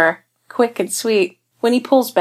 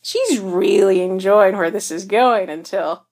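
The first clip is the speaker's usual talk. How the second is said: ordinary delivery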